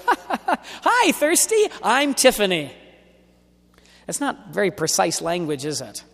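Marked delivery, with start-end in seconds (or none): 0.1-2.7 high, laughing joker voice teasing a pun; a friend laughing at their own bad dad joke; mocking laugh. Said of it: "And the person goes".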